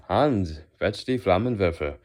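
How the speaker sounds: put-on loud, commanding German accent. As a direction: call it out in a hammed-up German accent. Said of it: "a German accent".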